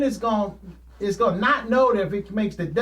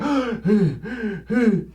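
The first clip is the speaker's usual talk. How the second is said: gasping for air; 3 dB louder than usual. Retold or imitated. Out of breath after sprints.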